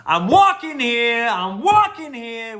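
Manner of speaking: hammed-up slow indignant street shout; Dustin Hoffman impression. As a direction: bark it at the taxi like an angry pedestrian. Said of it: "Now, that was Dustin Hoffman".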